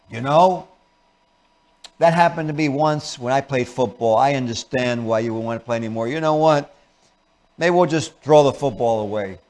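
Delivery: ordinary talk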